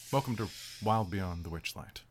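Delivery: measured storyteller read over music; story narration. A narrator's reading. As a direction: set the scene like a fairy tale. GM reads the campaign intro.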